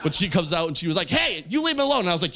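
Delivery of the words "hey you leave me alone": high-pitched mock telling-off; retold angry confrontation. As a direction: imitate an irate woman, pitched up. Angry woman confronts him, retold.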